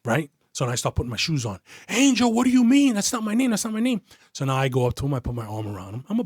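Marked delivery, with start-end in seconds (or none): 1.9-4.0 high-pitched imitation of pleading denial; mock-frantic protest; panicked protest. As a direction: plead loudly, voice jumping up high. Suspect pleading as agent leaves.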